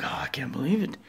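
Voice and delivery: whispered in disbelief; a hushed, stunned whisper; awed whisper. Real, not acted. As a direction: breathe it out, amazed. Amazed seeing new part light.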